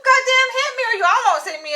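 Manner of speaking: high, loud, fast outburst; reenacted angry retort. Pedestrian retells arguing with the driver who nearly hit her, indignant yell. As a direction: fire it back at full indignation, retelling the row.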